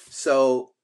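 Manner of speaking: ordinary talk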